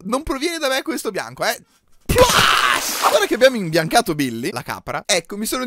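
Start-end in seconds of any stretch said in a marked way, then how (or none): 2.1-3.1 splash. Pretend. Paint bucket sound effect.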